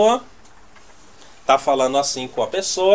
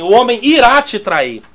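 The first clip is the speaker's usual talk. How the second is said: louder, higher proclaimed "o homem irá te trair"; mock bold prophecy; 5 semitones higher, 6 dB louder than usual. Preacher mocking someone declaring betrayal, loud. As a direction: announce it boldly like a prophet. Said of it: "dizer assim".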